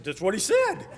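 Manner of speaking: high, loud defensive comeback; retold protest. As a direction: protest loudly, half laughing. Teller protesting to baffled friend.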